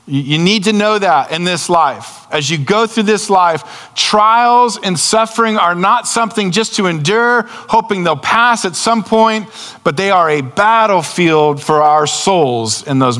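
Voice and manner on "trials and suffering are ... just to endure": normal talking voice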